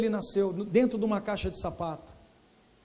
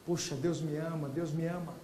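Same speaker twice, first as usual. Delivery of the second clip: much softer and lower, murmured over and over; tearful repeating; 6 semitones lower, 10 dB quieter than usual. Retold or imitated. Man realising God loves him, softly tearful. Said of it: "E ele não parava de dizer".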